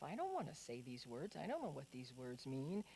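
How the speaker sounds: hushed private doubt; quiet inner thought. Retold or imitated. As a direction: think it to yourself under your breath. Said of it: "And I'm thinking to myself".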